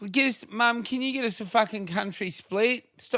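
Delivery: put-on whiny kid voice. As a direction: whine like a spoiled kid. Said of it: "that whiny kid's voice".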